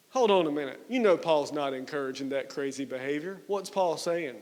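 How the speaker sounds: usual speaking voice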